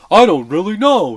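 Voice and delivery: loud, high, put-on newscaster voice; mock a bad actor's smug, fake delivery; shit-eating fake voice. Reviewer mocking the movie's terrible actor, in a shit-eating voice. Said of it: "kinda, like, shit-eating, like, voice".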